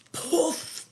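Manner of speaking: airy, high puff of a spell; sudden "poof" sound effect; magic poof. Acted.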